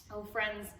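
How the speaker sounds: plain reading voice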